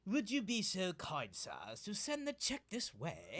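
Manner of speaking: slow, hammed-up posh English accent; slow mock aristocratic English request; posh British accent. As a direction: lay on the upper-class English politeness. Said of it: "Much better".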